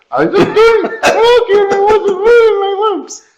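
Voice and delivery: high, slow ventriloquist dummy voice. Pretend. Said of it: "stuck in a, in a ventriloquist".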